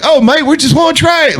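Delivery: high-pitched, badly faked British accent; terrible British accent. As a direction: put on an exaggerated, botched British chirp. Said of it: "my terrible British accent".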